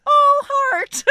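very high, loud cooing voice; sweet reply. Retold or imitated. Answering the vulgar fan, a high sweet coo.

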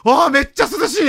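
high, relieved sigh of cool; like stepping into cool air; relieved exclamation. Retold or imitated. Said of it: "俺一言目".

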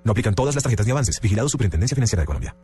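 rushed fine print read; fast legal disclaimer. Acted. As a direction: rattle off the small print.

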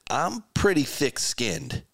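God shrugging off the yelling, lightly acted. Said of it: "you trusted a God that said".

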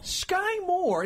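high-pitched mock holler; playful yell. Co-host yells a player's name despite being shushed, mock yelling. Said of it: "Let me yell".

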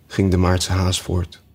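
ordinary delivery

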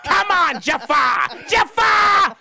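high screeching cartoon parrot; Iago parrot voice. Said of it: "first impression was Iago".

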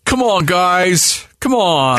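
slowly milk the announcer's appeal; mock pleading. Co-host parroting the game announcer, slow mock pleading. Mimicking the announcer's plea just played.

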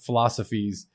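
ordinary talk, nothing marked

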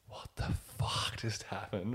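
whispered disbelief, drawn out; stunned whisper. Retold or imitated. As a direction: whisper it slowly, stunned, as if to yourself. Privately reacting after tense encounter.

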